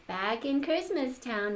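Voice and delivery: usual speaking voice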